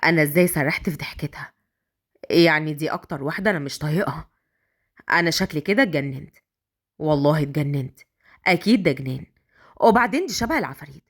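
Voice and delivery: low-pitched male character musing; inner monologue. A narrator's reading. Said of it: "وقال في نفسه".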